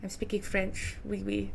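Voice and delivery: quiet French accent. Shown as a demonstration. Game master testing the villain's voice, quiet French accent. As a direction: try out a French accent, softly. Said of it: "let me try this accent again".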